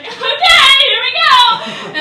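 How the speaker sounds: high, loud, strained mock bravado, slowing on here we go; nervous squeak. To the nurse in the hallway, high panicked forced cheer. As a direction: squeak it out, terrified but pretending to be brave. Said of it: "And I was like".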